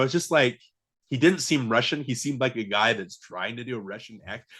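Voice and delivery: normal talking voice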